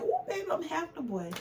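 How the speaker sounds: high, gleeful self-quote; excited crowing, retold; retold excitement. Retelling confident hopes for a boy.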